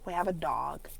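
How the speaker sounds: low, soft, unimpressed statement; unenthusiastic. Shown as a demonstration. Owner mentioning the dog, unenthusiastic. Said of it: "Notice the change of tone".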